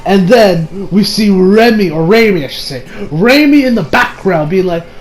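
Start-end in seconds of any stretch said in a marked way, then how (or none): none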